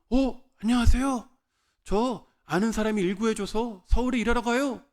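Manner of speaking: high, eager voice of the other man; glad greeting. Retold or imitated. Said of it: "이분도 제가 반가우셨나 봐요".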